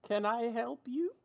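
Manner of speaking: tentative question, lifted high at the end; barmaid. Acted. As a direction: play a barmaid peeking out. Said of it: "barmaid comes, peeks her head out".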